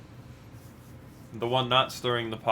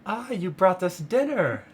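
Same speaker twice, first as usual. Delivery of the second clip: higher, gleeful predator; hungry witch voice; 9 semitones higher than usual. Acted. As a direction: delight at fresh prey. Dryad eyes the sister.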